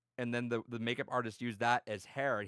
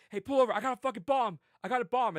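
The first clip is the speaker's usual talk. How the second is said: high, loud urgent order; acted threat; 11 semitones higher, 6 dB louder than usual. Prank victim threatening the taxi driver, high urgent yell. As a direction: yell it like a mock hijacker. Acting out fake bomb threat.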